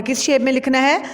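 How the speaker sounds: ordinary delivery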